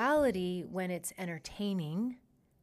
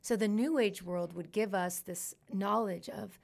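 ordinary delivery

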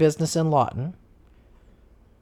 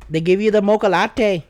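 higher, louder put-on Canadian accent; 4 semitones higher, 6 dB louder than usual. Shown as a demonstration. Kid asks for Canada, hammed-up Canadian accent. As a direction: ham up a friendly Canadian accent. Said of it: "Then do Canada".

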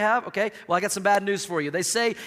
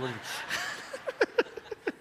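breathless laugh; 10 semitones higher, 9 dB quieter than usual. Real, not acted. Laughing at own joke.

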